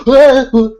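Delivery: high, loud yelps; hammed-up fright; mock startled yelps. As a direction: flinch at a touch. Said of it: "we'd be like".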